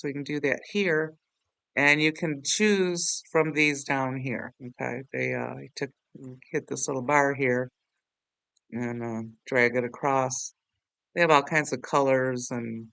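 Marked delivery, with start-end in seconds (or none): none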